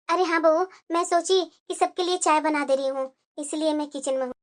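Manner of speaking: put-on an older mother-in-law, speaking low and soft to her bahu; mother-in-law answering her daughter-in-law, low and soft. Mother-in-law figure voiced.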